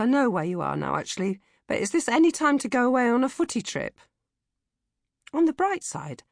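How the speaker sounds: usual speaking voice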